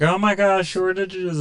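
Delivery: high-pitched freaked-out consumer; mock panic. Imagined shopper freaking out over shortages, mock panic.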